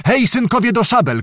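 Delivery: high, urgent rallying cry; shouted call to arms. A narrator's reading. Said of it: "krzyknęła kniachini". (wysoki okrzyk do broni)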